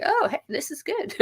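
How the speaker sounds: mock delighted realization; mock surprise. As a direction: play it as a sudden happy discovery. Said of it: "And I'm like".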